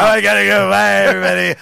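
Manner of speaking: mock exit voice. Jokey exit in put-on voice.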